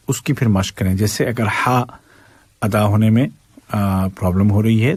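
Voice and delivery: normal talking voice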